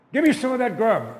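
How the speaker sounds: loud, high hungry demand. Acted. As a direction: pound the table and demand food. Hungry brother pounds table, demands food.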